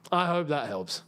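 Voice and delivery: dropped, altered voice with a falling end. Pretend. Repeats sign-off in lower voice.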